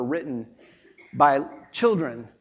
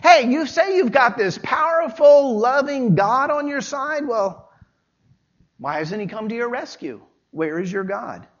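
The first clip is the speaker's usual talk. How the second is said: taunting enemies; 8 semitones higher, 4 dB louder than usual. Retold or imitated. Enemies taunting the psalmist, mocking and shouting. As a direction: taunt him as a sneering enemy. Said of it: "They're saying to him".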